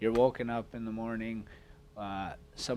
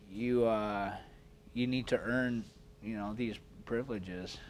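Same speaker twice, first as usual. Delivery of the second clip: normal talking voice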